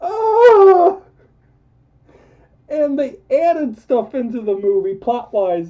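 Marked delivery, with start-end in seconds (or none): 0.1-1.0 laughing cry. Real, not acted. Laughing cry after shout.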